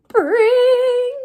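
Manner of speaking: high, loud ringing "bring" like a fire bell; imitated alarm bell. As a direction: ring it out like the station alarm.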